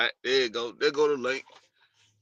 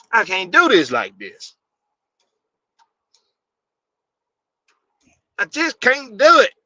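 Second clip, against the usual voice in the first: louder and higher, fed up and done with it; exasperated outburst; 5 semitones higher, 5 dB louder than usual. Real, not acted. Fed up with the stream tools, exasperated outburst. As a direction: let the frustration burst out.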